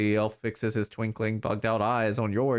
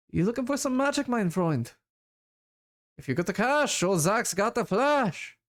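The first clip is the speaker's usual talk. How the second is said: put-on high, sing-song sales pitch in a vague European accent; made-up foreign accent; 10 semitones higher than usual. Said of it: "what accent I'm doing for him".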